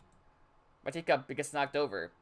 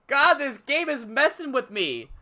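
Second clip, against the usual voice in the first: rattled laughing; 9 semitones higher, 8 dB louder, much slower than usual. Real, not acted. Rattled by the game.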